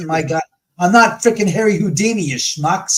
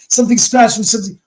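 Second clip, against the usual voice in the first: retold panicked blurt, louder and higher; alarmed cry; 5 semitones higher, 6 dB louder, faster than usual. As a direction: blurt it out like he just got scratched. Friend came running, scratched.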